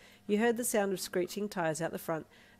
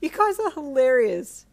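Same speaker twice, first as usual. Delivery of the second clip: laughing; 8 semitones higher, 10 dB louder than usual. Acted. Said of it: "you laughed".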